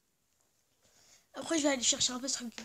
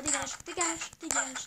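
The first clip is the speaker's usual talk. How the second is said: mock shoo; 5 dB louder than usual. Shooing a villager away.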